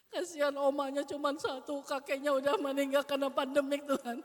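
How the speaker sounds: loud, hammed-up rambling prayer, partly laughing; mock a prayer that drags on and on. Shown as a demonstration. Teacher mocks a long-winded prayer over a child, loud and hammed-up. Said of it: "jangan yang bertele-tele".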